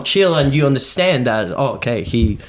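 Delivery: usual speaking voice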